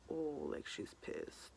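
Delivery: whispered dread; hushed inner panic. Retold or imitated. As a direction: barely voice the fear. Scared inner thought retold.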